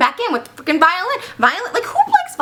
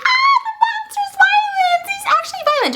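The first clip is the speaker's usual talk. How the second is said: mock crowd panic. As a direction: shriek like a terrified crowd.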